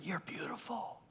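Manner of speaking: soft, far-off whisper; whispered compliment. Shown as a demonstration. Husband whispering to his wife, softly. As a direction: lean in and whisper it. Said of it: "You whisper".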